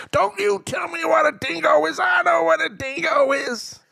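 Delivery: offended stranger yelling his correction; retold angry shout. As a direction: shout it back at him like he insulted you. Local man angrily corrects him.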